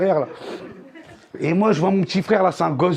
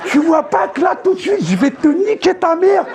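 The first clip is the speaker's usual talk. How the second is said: mock rant; 8 semitones higher than usual. Retold rant at daughter, laughing.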